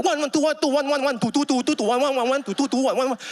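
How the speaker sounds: high, frantic muddled counting; flailing fast count. Retold or imitated. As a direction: scramble to keep up and lose the beat. Newcomer flailing to keep tempo.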